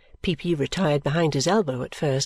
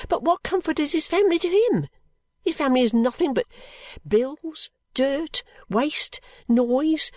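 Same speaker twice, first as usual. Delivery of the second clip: much higher, listing grievances bitterly; ranting girl; 7 semitones higher than usual. A narrator's reading. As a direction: spit out the list, a young girl at her wits' end. Caddy ranting.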